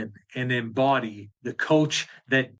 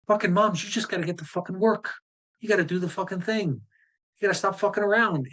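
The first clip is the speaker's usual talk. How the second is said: put-on a light, quick Australian accent, pitched higher and blunt; 6 semitones higher than usual. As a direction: imitate an Aussie mum coach telling moms straight, lightly. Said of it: "she's Australian".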